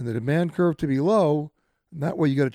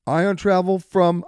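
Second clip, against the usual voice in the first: show ident; 6 dB louder than usual. Real, not acted.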